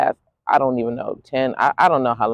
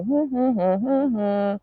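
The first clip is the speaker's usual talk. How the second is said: hesitant hum; 8 semitones higher, 6 dB quieter than usual. Retold or imitated. Hesitant humming at the plane.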